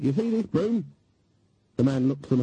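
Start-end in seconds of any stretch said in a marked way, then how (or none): 0.0-0.8 put-on much higher, insistent old witch; demanding. Granny confronting the nervous hotel clerk, demanding. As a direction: push it at him as a demand. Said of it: "she demanded".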